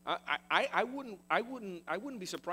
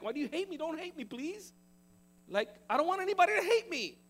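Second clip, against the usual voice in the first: high, pleading whine, climbing louder; 11 semitones higher, 4 dB louder than usual. Retold or imitated. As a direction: beg him not to hate you, voice rising. Preacher voicing his pleading self.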